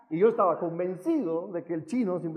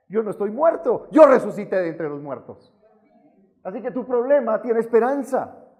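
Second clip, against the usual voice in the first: loud, forceful declaration in God's voice; emphatic divine reassurance; 4 semitones higher, 9 dB louder than usual. Acted. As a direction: proclaim it as the Lord, raised and emphatic. Voices the Lord answering his crying.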